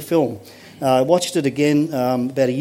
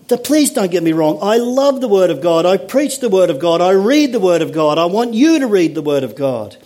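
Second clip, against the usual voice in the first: ordinary talk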